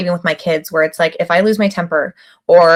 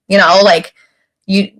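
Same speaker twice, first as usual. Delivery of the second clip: normal talking voice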